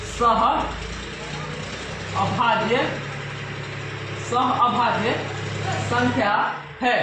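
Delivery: drawn-out words with long gaps; slow dictation. Real, not acted. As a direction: pace it for writing along, phrase by phrase. Dictating while writing out the proof.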